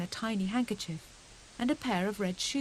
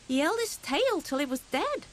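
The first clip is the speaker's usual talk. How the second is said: put-on high, eager small boy's boast; a small hedgehog bragging to his cousins; little hedgehog voice; 8 semitones higher, 3 dB louder than usual. Little hedgehog boasting to his cousins, high and eager. Fuzzy Peg continues boast.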